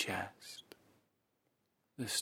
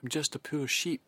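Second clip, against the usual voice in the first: higher, louder frightened bleat; bleating cub; 6 semitones higher, 5 dB louder than usual. Acted. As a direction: whimper it like a helpless lamb. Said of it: "bleated the cub".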